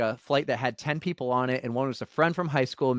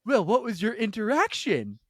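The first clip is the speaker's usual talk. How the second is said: lightly imitated tipsy seatmate, higher pitch; nosy tipsy question, voiced higher; 7 semitones higher than usual. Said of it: "a couple drinks in now".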